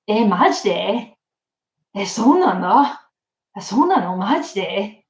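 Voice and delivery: lightly hammed-up boyish Japanese disbelief; super boyish. Shown as a demonstration. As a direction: question it like a casual Japanese guy. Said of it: "Super boyish".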